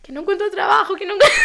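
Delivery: high wail breaking into laughter; mock crying. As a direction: imitate helpless sobbing, laughing through it. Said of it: "no podía parar de llorar".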